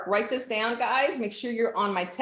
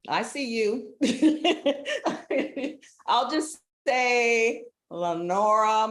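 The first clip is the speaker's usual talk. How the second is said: ordinary talk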